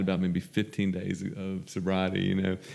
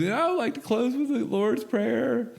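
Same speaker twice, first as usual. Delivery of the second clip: put-on high, slow, timid girl voice; a nervous newcomer squeaking out the closing; a shy young woman, high and slow, running her first meeting; timid little girl; 13 semitones higher, slower than usual. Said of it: "But she goes".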